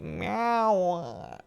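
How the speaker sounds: soft drawn-out sleepy meow; a drowsy little meow; sleepy cat answering owner; tired meow. Retold or imitated. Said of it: "her tired meows are very cute".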